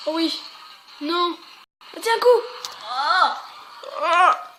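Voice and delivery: loud, high yelps at the action; excited shouts. Real, not acted. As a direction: yelp at each hit. Cris en pleine action du jeu. (cris excités)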